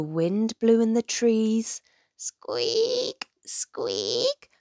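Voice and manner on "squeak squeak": high thin creaky squeak; little creaking squeaks; squeaking lanterns. A narrator's reading. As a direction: imitate swinging lanterns squeaking. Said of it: "went the lanterns".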